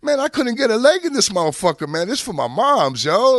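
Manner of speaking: very high, loud mock outrage; imitated loud protest. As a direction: act out a friend yelling in protest. Pun protests the car is tiny.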